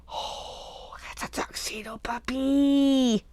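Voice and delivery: slow, hushed, delighted whisper. Real, not acted. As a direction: marvel at it under your breath. Discovering the tuxedo puppy die.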